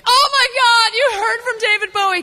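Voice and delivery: very high, fast squeal of excitement; hammed-up excitement. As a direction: squeal like an overjoyed fan. Acts out thrilled reply to lawyer.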